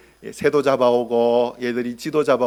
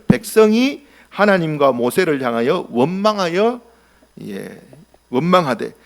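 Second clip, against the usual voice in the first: usual speaking voice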